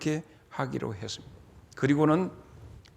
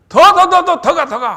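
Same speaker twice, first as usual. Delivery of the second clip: loud, much higher yelling to go farther; frantic high shouts of more; urgent shout; 13 semitones higher, 15 dB louder than usual. Acted. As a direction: drive the boy on with all your strength. Shouting arrow boy farther.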